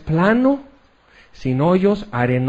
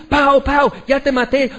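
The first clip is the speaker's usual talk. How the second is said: put-on child at play; 7 semitones higher than usual. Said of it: "yo así".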